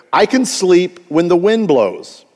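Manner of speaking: normal talking voice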